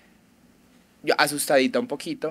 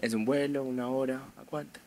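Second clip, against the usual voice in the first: quiet inner thought; 9 dB quieter than usual. Retold or imitated. Retold inner thought on plane.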